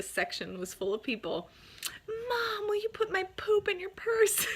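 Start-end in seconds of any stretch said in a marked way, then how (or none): 2.1-4.5 very high-pitched mimicked kid calling to Mom; imitated boy's yell. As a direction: replay a son's embarrassing public request in a high kid voice. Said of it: "at the top of his lungs, he yells".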